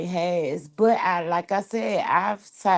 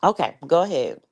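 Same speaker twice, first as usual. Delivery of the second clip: normal talking voice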